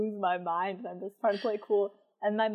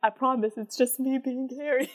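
pleading; 4 semitones higher than usual. Retold or imitated.

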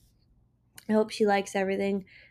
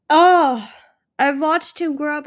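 emotional; 6 semitones higher, 11 dB louder than usual. Real, not acted. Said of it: "I'm emotional".

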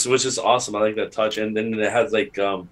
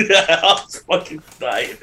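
laughing; 6 semitones higher than usual. Real, not acted. Laughing at the scene.

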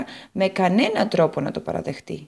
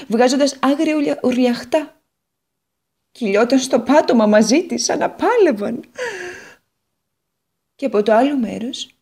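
bitter guitar; 5 semitones higher, 4 dB louder than usual. Acted. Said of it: "Η φωνή της έγινε πιο πικρή".

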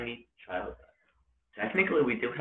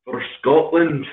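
loud, high, drawn-out Scottish cry; rally them in a Scottish accent; 7 semitones higher, 13 dB louder, slower than usual. Shown as a demonstration. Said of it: "like, Scottish accent".

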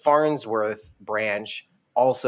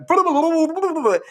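high garbled underwater yell; mock shout through water and food; 4 dB louder than usual. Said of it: "Fry is still, like, going".

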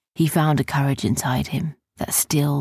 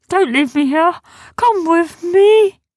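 high, loud creature wail, slowing at the end; drawn-out desperate plea; begging Sniz; 12 semitones higher, 9 dB louder than usual. Acted. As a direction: beg a friend to follow. Sniz begs not to be left.

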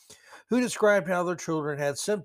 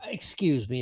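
usual speaking voice

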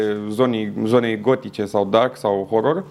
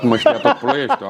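high and slow, through laughter; laughing; 10 semitones higher, slower than usual. Real, not acted. Telling the host how he yelled out the window, laughing. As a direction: laugh as you remember the whole town hearing. Laughing while recalling screaming joy.

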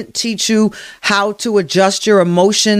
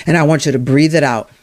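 ordinary talk

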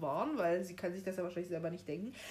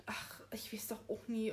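a hushed, breathless thought to herself; whispered inner thought; 8 dB quieter than usual. Retold or imitated. Hermine thinking to herself on the stairs, whispered. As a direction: whisper it like a private worry. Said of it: "sich so denkt".

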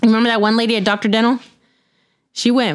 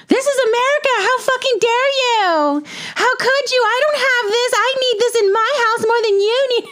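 mock entitled outrage; 14 semitones higher than usual. Thief ranting at homeowner, mock outraged high shriek. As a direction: parody a self-righteous looter yelling. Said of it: "and saying".

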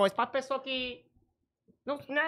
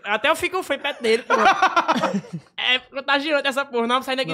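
talking through laughter; laughing; 8 dB louder than usual. Real, not acted. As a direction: laugh while speaking.

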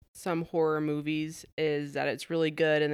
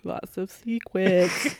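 spooky drawn-out voice; 3 semitones higher, 5 dB louder, much slower than usual. Retold or imitated. Spooky slow imitation.

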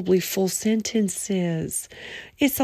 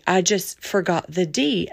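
usual speaking voice

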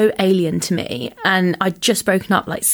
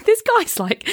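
ordinary talk

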